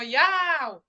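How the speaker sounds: loud retold cry of the name; retold shout. Niece bursting outside the church, shouting the name loudly. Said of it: "ela correu pra fora e gritou".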